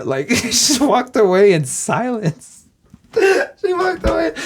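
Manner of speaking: laughing through the words, high; cracking up while telling it. Real, not acted. Telling friends how the waitress stormed off, laughing hard.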